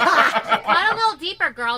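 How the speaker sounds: loud, slow, laughing high call; laughing tease. Real, not acted. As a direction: egg her on through laughter. Egging on while laughing.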